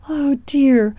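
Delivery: upset, high oh dear; distressed. Acted. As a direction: fret before the tears come. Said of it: "cried Aunt Flounder".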